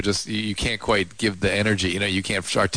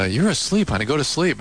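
higher, gentle coaxing voice; soothing mom; 6 semitones higher than usual. Retold or imitated. Dad imitating his wife calming their daughter. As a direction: coax a sleepwalking child back to bed. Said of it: "she goes".

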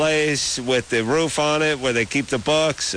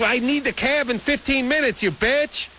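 angry retold outburst; 14 semitones higher than usual. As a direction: snap at the dispatcher. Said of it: "I'm like".

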